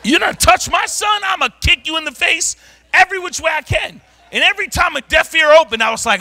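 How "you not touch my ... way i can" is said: shouted threat, pitched up; retold threat. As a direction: bark it like you mean to fight. Threatening someone over his son.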